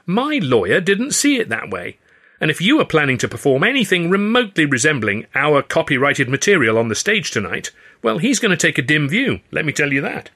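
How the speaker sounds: smug. A narrator's reading. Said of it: "exasperating smugness".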